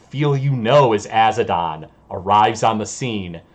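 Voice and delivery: usual speaking voice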